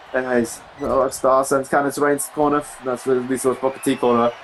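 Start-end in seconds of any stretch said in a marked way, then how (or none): none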